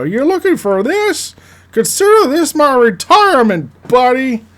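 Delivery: very high, loud cartoon critter voice; smug cricket. Cricket taunting Jack with the map piece, squeaky smug gloat. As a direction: gloat like a tiny smart-mouthed cricket. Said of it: "show up and go".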